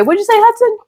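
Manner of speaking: mock confused asking; high-pitched question. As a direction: squeak it out like you missed it. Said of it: "I can say".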